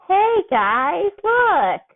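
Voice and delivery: put-on a kid begging everyone to watch; excited showing off. A narrator's reading. Teen showing off on chandelier.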